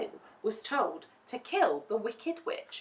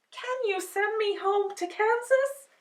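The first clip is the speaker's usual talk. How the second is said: put-on young girl voice; 14 semitones higher, 4 dB louder than usual. Dorothy pleads with the wizard.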